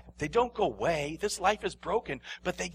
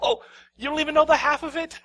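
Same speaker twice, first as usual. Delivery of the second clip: higher, louder, quick and laughing; 12 semitones higher, 9 dB louder, faster than usual. Shown as a demonstration. Preacher showing how to laugh off criticism, laughing. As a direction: laugh it off as you speak. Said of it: "You can laugh at him".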